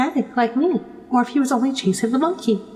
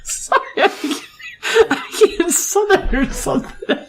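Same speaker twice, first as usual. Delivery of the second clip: laughing; 6 semitones higher than usual. Real, not acted. Breaks up after cough.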